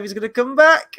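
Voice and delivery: excited, loud and high. Retold or imitated. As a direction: blurt it out thrilled. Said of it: "you're like".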